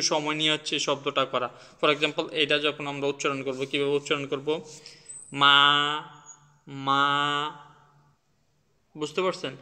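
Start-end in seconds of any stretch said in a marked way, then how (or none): 5.3-7.5 মা held long and level; drawn-out, unbending মা; flat first tone. Shown as a demonstration. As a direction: hold মা on one steady note, unhurried. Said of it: "high and flat".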